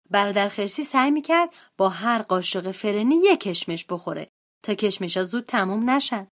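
ordinary delivery, nothing marked

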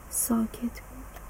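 hushed whisper for the silent house; whispered narration. A narrator's reading. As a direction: breathe the words out.

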